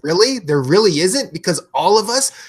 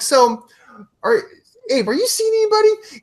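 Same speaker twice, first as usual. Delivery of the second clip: mock innocent question; 11 semitones higher, 3 dB louder than usual. Teasing question to friend Abe.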